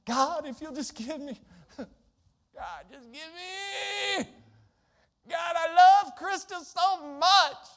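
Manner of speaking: hammed-up begging prayer, breaking into a wail; mock whiny prayer. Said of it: "Again, I'm being facetious".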